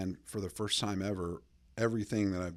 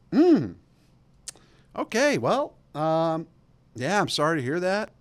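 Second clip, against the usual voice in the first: flustered reply; 8 semitones higher, 9 dB louder than usual. Retold or imitated. Awkward reply to hot coworker.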